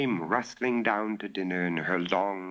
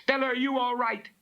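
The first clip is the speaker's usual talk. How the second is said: high, fast anxious calling out; worried shout; faster than usual. Acted. Innkeeper shouting down to Bella, high anxious call. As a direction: call out frantically for the girl. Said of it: "George Alderson shouted from overhead".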